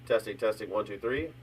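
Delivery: faint, distant mic test; words barely reaching the mic; far from mic. Real, not acted. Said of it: "More crisp, but really low".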